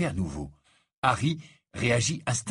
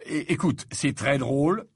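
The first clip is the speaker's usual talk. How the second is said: higher, impatient interruption; annoyed; 5 semitones higher, 4 dB louder than usual. A narrator's reading. Harry interrupts his lovesick friend, annoyed. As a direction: cut in with irritation. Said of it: "l'interrompit Harry agacé".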